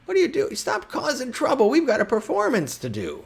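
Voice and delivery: very high, loud gnome scolding; exasperated shout in a high gnome voice; Bumblebean shouting. Acted. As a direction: yell it high, bossing them about. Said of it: "He's, he's shouting".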